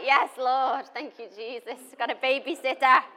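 loud, high burst of joyful thanks to God; excited thanks. Retold or imitated. Telling the church her joy at finding a babysitter, loud and thrilled. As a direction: shout your thanks like a prayer answered.

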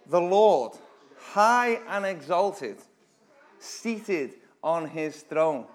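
loud, slow, drawn-out awe; awestruck proclamation. Acted. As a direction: let the words hang in wonder. Builds the vision for listeners.

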